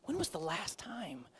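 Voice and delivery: breathy whispered question. Real, not acted. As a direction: drop to a whisper to draw them in. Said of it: "whispered rhetorical question".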